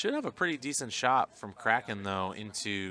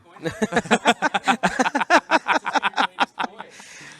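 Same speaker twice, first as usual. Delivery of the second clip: loud high laugh; 12 semitones higher, 10 dB louder than usual. Real, not acted. Laughs at own joke.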